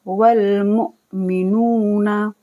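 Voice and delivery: मुमिनूना with the नू held long; slow Quranic recitation; drawn-out nu. Shown as a demonstration. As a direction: stretch नू in मुमिनूना. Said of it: "नू को खींचेंगे".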